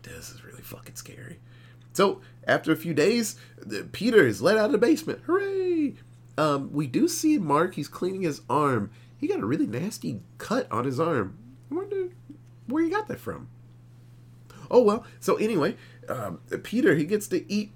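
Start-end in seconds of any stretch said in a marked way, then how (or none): 0.0-1.4 hushed, breathless whisper of fear; frightened whispered aside; scared whisper. Retold or imitated. Teacher scared of Mark. 5.3-5.9 mock cheer. Real, not acted. Sarcastic cheer. 11.7-13.5 sarcastic wonder. Real, not acted. Sarcastic hint.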